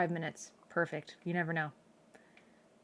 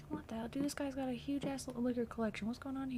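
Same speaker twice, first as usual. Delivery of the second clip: hushed; 5 semitones higher, 11 dB quieter than usual. Real, not acted. Sneaking through a house.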